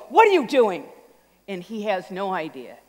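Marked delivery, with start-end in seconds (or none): 0.1-0.8 sharp mom question. Retold or imitated. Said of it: "his mom stops just long enough to go".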